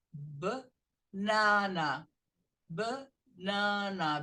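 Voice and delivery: "banana" in two slow pieces, buh, then NA-na; B plus nana. Shown as a demonstration. Teacher drills the pieces for students, "banana" as b'-nana. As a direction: break "banana" after a short b', pause, then nana. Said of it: "Você vai pronunciar o B e vai pronunciar o nana".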